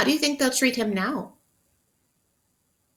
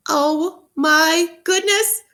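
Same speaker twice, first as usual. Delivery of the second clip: slow, high, louder oh my goodness; drawn-out amazed gasp of wonder; 6 semitones higher, 6 dB louder, much slower than usual. Shown as a demonstration. Teacher reading aloud to class, drawn-out amazed exclamation. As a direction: stretch it out in wonder. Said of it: "it makes you wait to turn the page".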